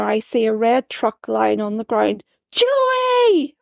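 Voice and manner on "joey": put-on terrified scream for a lost child. A narrator's reading. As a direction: scream it from deep inside. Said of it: "I scream from deep within".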